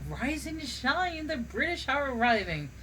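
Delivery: lightly hammed-up high-pitched holler; mock considerate shout. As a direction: call it out high, like a polite wake-up. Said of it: "than to shout".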